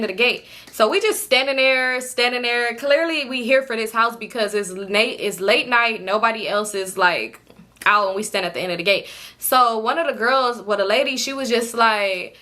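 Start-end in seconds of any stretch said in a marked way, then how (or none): none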